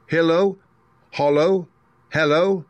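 loud, high magic call. A narrator's reading. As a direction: call the charm out loudly. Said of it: "Dorothy went on".

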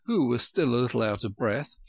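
usual speaking voice

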